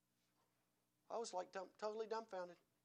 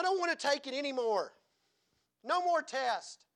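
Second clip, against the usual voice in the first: louder, higher protest of exhaustion; fed up; 8 semitones higher, 9 dB louder than usual. Acted. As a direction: cry out that you've had enough. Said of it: "and you're tired".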